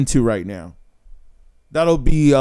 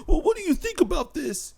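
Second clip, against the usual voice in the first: much higher, soft and pleading; needy validation-seeker; 12 semitones higher, 7 dB quieter than usual. Retold or imitated. Man seeks his woman's approval, high and timid. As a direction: ask sheepishly, begging approval. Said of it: "and be like".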